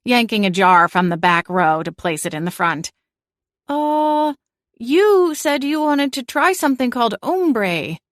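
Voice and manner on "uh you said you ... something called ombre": put-on higher, timid young cat voice; hesitant young cat. A narrator's reading. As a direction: say it unsure, a little hesitant. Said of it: "a little hesitantly".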